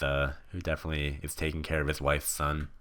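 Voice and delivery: ordinary talk, nothing marked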